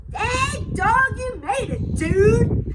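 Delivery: relieved, slow and loud buddy greeting; Don Diego. Acted. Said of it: "explained Don Diego".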